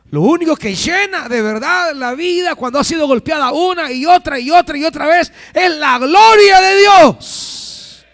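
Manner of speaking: high, full-force preaching shout; predicación a gritos. Real, not acted. (predicando a gritos, con toda la fuerza)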